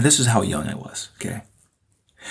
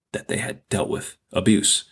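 ordinary delivery

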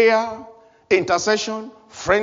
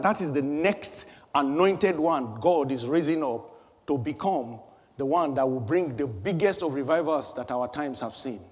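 usual speaking voice

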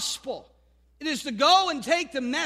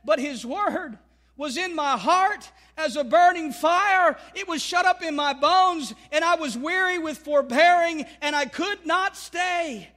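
declaimed prophet's words; 5 semitones higher, 5 dB louder than usual. Acted. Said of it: "And Jeremiah said".

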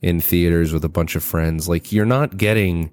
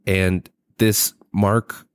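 usual speaking voice

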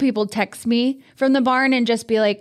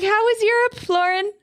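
forced cheer; 9 semitones higher than usual. Retold or imitated. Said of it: "I was like".

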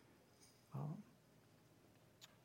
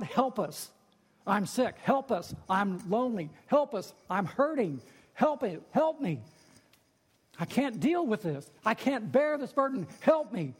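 high, louder desperate pleas; pleading cry; 6 semitones higher, 3 dB louder than usual. Acted. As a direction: beg God for help, raising it. Voicing believers' pleading prayers.

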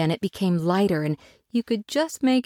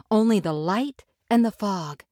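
ordinary delivery

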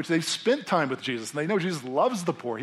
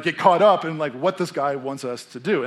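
ordinary delivery

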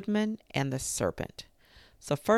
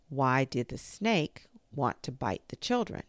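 ordinary delivery

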